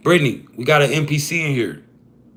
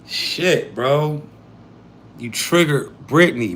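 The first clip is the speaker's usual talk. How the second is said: usual speaking voice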